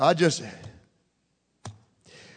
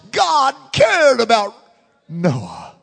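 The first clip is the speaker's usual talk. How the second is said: slow, higher, louder shout; preacher's shout; 3 semitones higher, 4 dB louder, slower than usual. Real, not acted. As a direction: shout it out slowly to the church.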